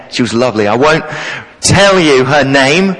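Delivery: ordinary talk, nothing marked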